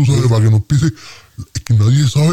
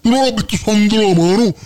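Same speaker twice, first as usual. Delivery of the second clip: higher, outraged protest at the heckler; indignant protest; 6 semitones higher than usual. Acted. Answering the heckler who yells liar, indignant and high. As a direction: bristle at being called a liar.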